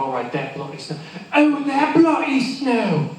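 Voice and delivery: British worker, rising to a slow high wail; British grumble turning into a drawn-out cry; British accent. Acted. Snowplow worker repeats orders, British accent swelling high. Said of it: "an excuse for me to do an accent".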